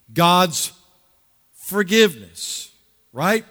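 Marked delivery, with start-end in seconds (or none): none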